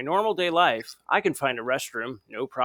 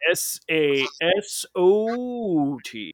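very slow, higher radio jingle voice; Coyote dude voice; 6 semitones higher, much slower than usual. Retold or imitated. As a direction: spell it out slowly like a radio DJ. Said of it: "the Coyote dude voice would be like".

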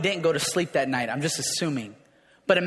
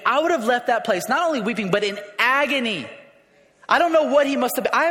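normal talking voice

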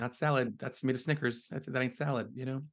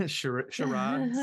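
squeaky charades; 10 semitones higher, much slower than usual. Acted. Jokey title read.

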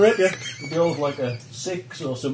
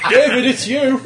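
laughing; 9 semitones higher, 7 dB louder than usual. Real, not acted. Laughing realization at the table.

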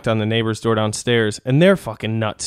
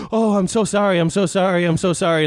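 higher, gushing repeated sorry; mock frantic apology; 7 semitones higher than usual. Landlady apologizing frantically, lightly mocked higher. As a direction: play the panicking landlady apologizing. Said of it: "she freaked out and was like".

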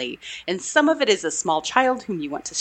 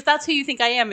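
amused disbelief; 7 semitones higher, faster than usual. Retold or imitated. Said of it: "I love her intonation on that line".

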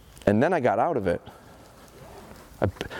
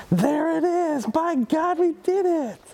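much higher, jubilant praise for the dog; high excited praise; slower than usual. Real, not acted. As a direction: celebrate the breakthrough with the dog. Dog finally succeeds, trainer celebrates.

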